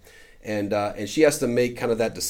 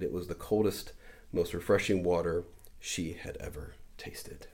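softer, almost whispered; hushed; 3 semitones lower, 8 dB quieter than usual. A narrator's reading. Preacher ends the story, softly hushed. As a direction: let it fall to a hush.

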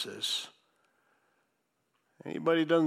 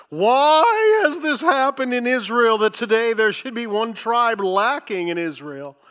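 loud, high wail of complaint; theatrical pulpit outcry, easing slightly; lifted-voice lament; 7 semitones higher, 10 dB louder than usual. Retold or imitated. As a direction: cry out in imitation of a grieving people, starting very high. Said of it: "lifted up their voices and wept bitterly".

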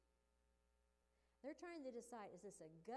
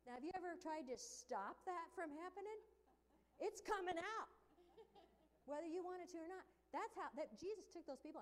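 much higher and louder, pushing hard; loud preaching; 7 semitones higher, 8 dB louder than usual. Real, not acted. Preacher describing something you can't stop, loud and high. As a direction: drive the image home at full force. Preacher builds vomit image intensely.

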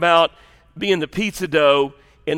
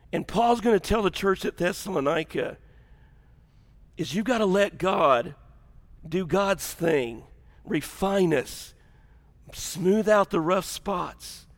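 softer, slow and earnest; soft earnest preaching; 8 dB quieter than usual. Real, not acted. Preacher explaining Paul's message to the church, soft and slow. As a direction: let each phrase settle gently.

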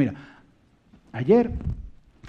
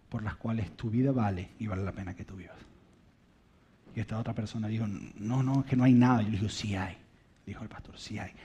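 soft, low and gentle; tender reassurance; 6 semitones lower, 9 dB quieter than usual. Retold or imitated. Pastor reassuring a suicidal leader, soft and tender. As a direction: gently insist he is worth it.

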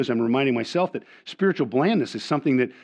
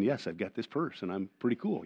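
mock smug; 3 semitones lower, 11 dB quieter than usual. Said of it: "some women are going".

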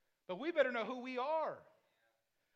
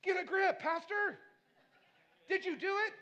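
high, loud friend snapping him out of it; exasperated friend telling Pastor to get a grip; mock scolding; 7 semitones higher, 6 dB louder than usual. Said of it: "you're gonna be like".

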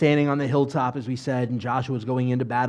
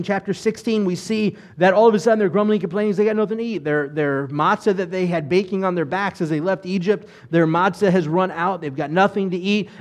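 ordinary talk, nothing marked

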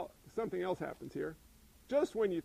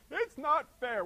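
whiny complaint; 6 semitones higher, 3 dB louder, slower than usual. Retold or imitated. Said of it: "We hear that in my house all the time".

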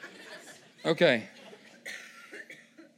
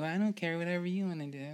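limp indifferent man; 7 dB quieter, faster than usual. Retold or imitated. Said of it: "someone that's like".

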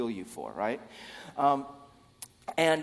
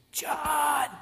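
loud, high strained hiss; whisper-shout to get his attention; insistent stage whisper; 5 dB louder than usual. Acted. Peter trying harder to get John's attention, whisper-shouted.

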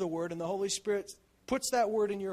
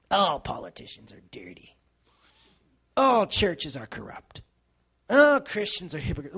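cynic voice; 3 semitones lower, 8 dB louder than usual. Retold or imitated. Mocking the cynic's voice.

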